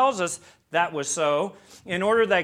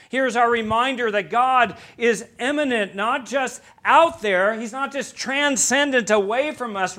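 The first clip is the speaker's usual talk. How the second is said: preaching at full force; 5 semitones higher, 5 dB louder than usual. Acted. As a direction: proclaim it to the whole congregation. Preacher drives home God's nearness.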